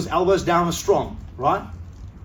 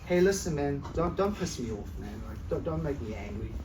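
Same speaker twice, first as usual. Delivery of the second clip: softer, hesitant mock threat with no bite; timid fake tough guy; 5 semitones lower, 9 dB quieter than usual. Shown as a demonstration. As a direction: pretend to be angry but shrink back. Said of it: "am I gonna be like this?".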